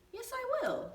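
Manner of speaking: a kind neighbour singing out yes; Missus Hill's voice. A narrator's reading. Missus Hill agrees to sell Benny a rose, high and sweet. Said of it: "said Missus Hill".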